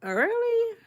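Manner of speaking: high disbelieving squeak on "Really?"; surprised. Real, not acted. Streamer reacting to a chat comment about her accent, high surprised squeak. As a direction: shoot the pitch way up in disbelief.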